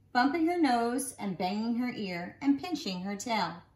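ordinary delivery, nothing marked

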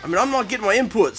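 ordinary delivery

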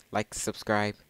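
usual speaking voice

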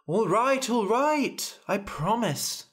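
high, hurried-sounding exasperated surrender; flustered giving in. Acted. King giving in to his crying daughter, high and exasperated. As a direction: give in to stop the wailing.

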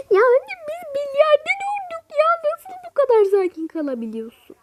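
very high, thrilled squeal; excited squeal. Acted. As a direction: squeal with delight. Çocuk milyarder olduklarına coşuyor. (çok ince, heyecanlı ciyaklama)

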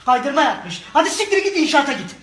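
screaming angry father; furious yelling. Acted. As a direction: bellow the insults at full volume.

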